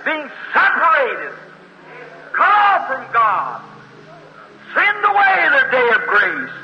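preacher warns of spiritual death; fervent preaching. Real, not acted. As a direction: lift it with fervor. Preacher's climax.

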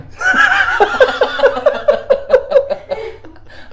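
long, shrieking, helpless laughter; high hysterical laugh. Real, not acted. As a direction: laugh until you can't stop.

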